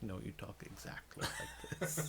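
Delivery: slow, airy impersonation; John Malkovich. Retold or imitated. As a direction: draw it out softly, nearly whispered. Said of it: "John Malkovich impression".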